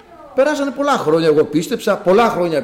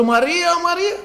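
high, rising call of a name; re-enacted call for help, not shouted; retold calling out; 13 semitones higher than usual. Father calls the cleaning woman over his failing baby, retold high calling out. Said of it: "Εκείνη την φωνάζω".

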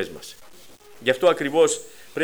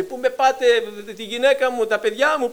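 retold desperate cry; 7 semitones higher than usual. Man seized by the claw, crying out, high pleading shout. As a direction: cry out as you're dragged away. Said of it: "αυτός φώναζε".

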